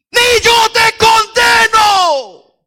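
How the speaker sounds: drawn-out, high full-throated shout; huge shout. Acted. Preacher acting Jesus pardoning the accused woman, huge shout. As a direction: bellow it slowly, like the shout of all history. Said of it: "haber pegado el grito de la historia".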